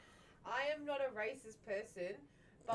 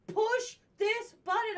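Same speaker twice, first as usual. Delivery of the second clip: high, slow, louder order; frustrated scammer; 11 semitones higher, 7 dB louder, much slower than usual. Retold or imitated. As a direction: bark it like an impatient caller. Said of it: "They're like".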